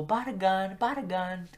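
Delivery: high, sing-out surname call; imitated teacher's call. Teacher calling student by last name, high imitated call. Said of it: "She would go".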